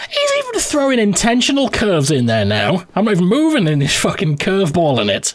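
high-pitched, indignant disbelief; real outraged whine at an unfair game; incredulous protest. Real, not acted. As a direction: complain in a high, exasperated voice. Protesting at enemy's curving shots.

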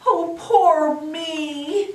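high, drawn-out storybook wail. A narrator's reading. Lost bear huddled by a tree, wailing mournfully. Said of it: "and he wails".

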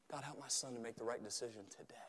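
hushed, quick murmured plea; whispered prayer. Acted. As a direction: whisper it privately to God.

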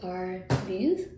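pulling lip aside; speaking around finger; finger in mouth. Real, not acted. Seen 0: finger at lip from 1.1, pulls mouth open showing expander.

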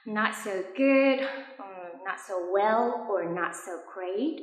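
slow, careful list of replies; slow examples. Shown as a demonstration. Teacher listing three replies slowly for learners. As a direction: lay out each reply slowly. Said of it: "mình sẽ trả lời là".

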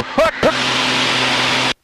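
straining breathy grunts against g force; under heavy g force. Real, not acted. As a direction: grunt it out while tensing the stomach. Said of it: "this time we're gonna do the hook".

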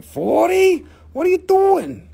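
high, loud mock disbelief; imitating Nicole. Seller imitating coworker aghast at the forty-dollar price, high mock outrage. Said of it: "Nicole's over here like".

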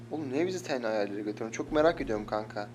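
usual speaking voice

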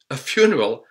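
ordinary talk

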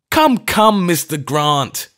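high, loud, out of breath; breathless old vicar, cornered after the chase; panting. A narrator's reading. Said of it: "he panted".

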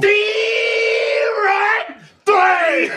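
very high drawn-out strike call; umpire shout. Acted. Mocking umpire calls the final strike, shouting. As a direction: stretch the strike call into a wail.

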